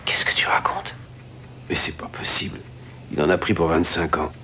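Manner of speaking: whispering. Acted. Partner asleep beside him in bed.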